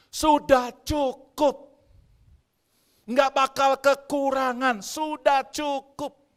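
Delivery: loud, high, drawn-out declaration; emphatic proclamation. Real, not acted. Preacher assuring the congregation nothing will run short, emphatic proclamation. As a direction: proclaim it forcefully, stretching each word. Preacher drives home the main point.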